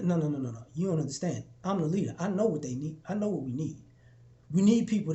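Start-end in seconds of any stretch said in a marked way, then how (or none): 0.0-3.8 soft, low, insistent no's; gently but firmly refuse the praise; leader waving off credit. Retold or imitated.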